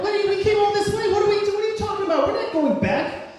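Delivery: very high, loud, rapid rant; hysterical, high-pitched objection; frantic protest. Retold or imitated. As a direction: shriek your disbelief in a rush. Acted protest at turning back.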